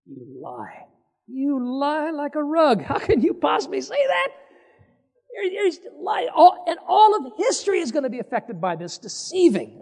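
high, far-off rebuke of a liar; rising indignant scolding; scolding accusation. Acted. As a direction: call him out on his lie. Preacher scolds deceiving Jacob directly.

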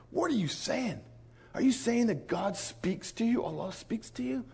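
high, softened voice of disbelief; incredulous questioning. Retold or imitated. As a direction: imitate a man who can't believe his ears.

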